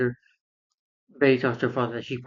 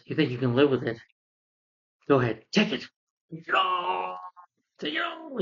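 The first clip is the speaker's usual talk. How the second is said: hammed-up defiant yell rising higher; over the top acting; 6 semitones higher than usual. Reviewer imitates Bruce's overacted taunt at the villain, hammed-up. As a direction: taunt him to take it all, overacted. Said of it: "over the top acting".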